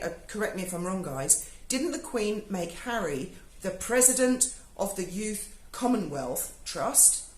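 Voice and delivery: ordinary talk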